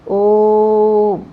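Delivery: ओम with the vowel held long and level, like a chant; drawn-out om. Shown as a demonstration. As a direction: stretch the o of ओम to three beats, one steady tone. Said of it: "प्लुत स्वर कहते हैं".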